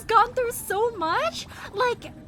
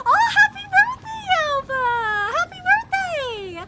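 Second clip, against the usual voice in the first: excited birthday cheer; 9 semitones higher, 7 dB louder than usual. Real, not acted. Singing-style birthday greeting to viewer.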